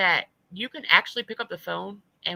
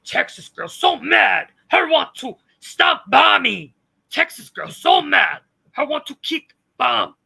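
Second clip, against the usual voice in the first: loud, slow caveman-style rant; exaggerated, raised-pitch mocking yell; mock yelling Texas girl; 5 semitones higher, 12 dB louder than usual. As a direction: hammer it out as an angry brute. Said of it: "every time she yells".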